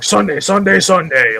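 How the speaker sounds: loud booming announcer hype; monster truck ad. Retold or imitated. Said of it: "the old school monster truck adverts".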